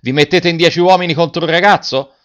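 loud, high indignant shout. A narrator's reading. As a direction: shame the angry men.

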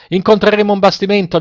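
high, urgent narrated shout; sailors' shout. A narrator's reading. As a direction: call up to the captain, pitched well up. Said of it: "gli gridarono i marinai".

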